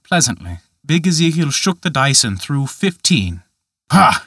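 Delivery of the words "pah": a loud, scornful "Pah!". A narrator's reading. Ezekiel scoffs at his throw with a loud pah. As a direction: spit the sound out in contempt. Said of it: "he cried".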